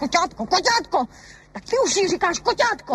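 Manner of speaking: high, indignant repetition; seizing on the word; jealous outrage. Acted. Wife catches husband calling the neighbour kitten, jealous outrage.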